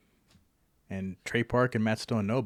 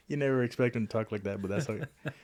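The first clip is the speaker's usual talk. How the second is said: usual speaking voice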